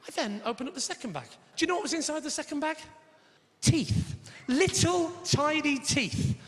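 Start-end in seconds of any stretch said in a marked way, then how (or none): none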